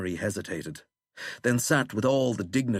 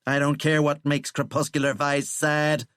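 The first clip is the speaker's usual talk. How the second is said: raised, scornful sneer; 6 semitones higher, 4 dB louder than usual. A narrator's reading. President dismissing Withering's employer, sneering.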